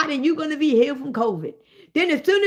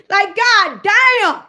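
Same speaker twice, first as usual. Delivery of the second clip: loud, slow, drawn-out God damn; exasperated outburst; 4 semitones higher, 5 dB louder, much slower than usual. Real, not acted. Streamer to viewers, loud drawn-out disbelief.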